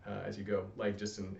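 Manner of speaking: ordinary talk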